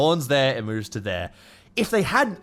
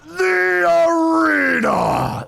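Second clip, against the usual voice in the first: drawn-out thrilled yell of the arena; excited shout; 13 semitones higher, 6 dB louder, much slower than usual. Real, not acted. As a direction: shout it out slowly with pure glee.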